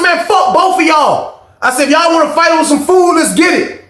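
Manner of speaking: high, loud dare to the room; shouting a challenge to the whole cafeteria; high challenging shout. Retold or imitated. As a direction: square up to everyone.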